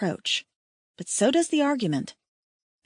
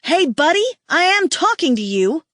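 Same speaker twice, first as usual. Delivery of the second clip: loud, much higher angry yell; 7 semitones higher, 6 dB louder than usual. A narrator's reading. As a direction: yell to grab his attention. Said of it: "the woman yells at my mate".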